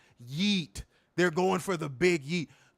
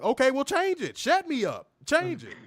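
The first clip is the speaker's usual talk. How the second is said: high, louder mock protest; mock-indignant outburst; 12 semitones higher, 5 dB louder than usual. Real, not acted. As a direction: throw the challenge back, worked up. Goaded by co-host.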